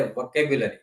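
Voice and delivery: "વોકેબ્યુલરી" said as vo-KE-byu-la-ri; demonstrated pronunciation. Shown as a demonstration. Teacher showing students how it is pronounced, "વોકેબ્યુલરી" as vo-ke-byu-la-ri. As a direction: say each syllable of "વોકેબ્યુલરી", vo-ke-byu-la-ri. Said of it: "એનો ઉચ્ચાર થાય".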